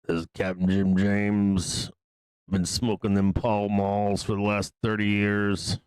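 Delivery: put-on slow, rasping old smoker voice; Captain Jim James. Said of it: "I sound like a fucking smoker".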